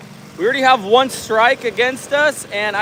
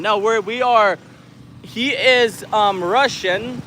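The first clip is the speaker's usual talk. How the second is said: ordinary talk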